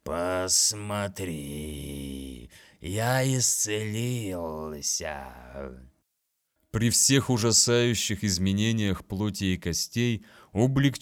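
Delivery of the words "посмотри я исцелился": slow, creaking swarm voice; mock the captain, slow and gloating; buzzing monster voice. Swarm creature voice.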